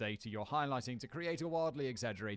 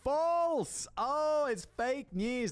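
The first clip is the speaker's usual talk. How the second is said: much higher, louder exclamation; startled outburst; loud surprise; 12 semitones higher, 5 dB louder, much slower than usual. Real, not acted. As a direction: shout it in disbelief. Shocked reaction to result.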